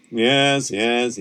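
ordinary delivery, nothing marked

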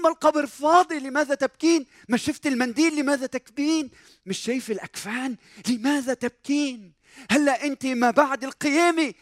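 high, urgent, repeated challenge; insistent reproach. Acted. Acts out angels chiding weeping Mary. (عتاب ملحّ بنبرة مرتفعة)

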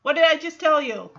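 loud, insistent correction; mock scolding from a frustrated teacher; impatient dad. Retold impatient dad correcting guitar lesson.

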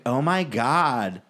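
drawn-out, over-the-top flamboyant voice; slow, campy mock exclamation; flamboyant guy imitation. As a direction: mimic a flamboyant man, stretching each word. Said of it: "This guy was talking like".

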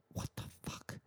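very soft, voiceless aside; whispered disbelief. Acted. Stranded passenger reacting to the airline agent, whispered disbelief. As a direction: whisper it under your breath.

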